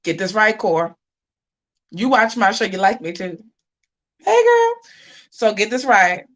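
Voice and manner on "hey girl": high, loud sing-song hello; sweet mock greeting. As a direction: greet her like a falsely sweet friend.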